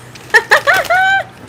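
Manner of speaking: squealing high laugh. Real, not acted. Laughing to viewers at the Grease joke, high squeal.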